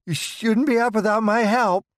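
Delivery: high, frightened call through the door; shaky yell. Acted. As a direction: call out, voice trembling with fear. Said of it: "She yells out in a shaky voice".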